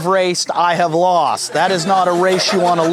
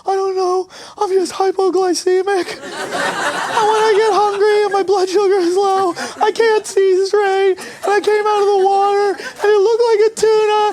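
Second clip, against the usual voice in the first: much higher, pleading whale confessing; 13 semitones higher than usual. Retold or imitated. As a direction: squeak it out like a sorry whale caught in interrogation. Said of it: "It was like".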